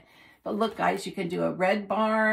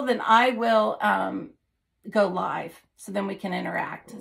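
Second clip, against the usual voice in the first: ordinary delivery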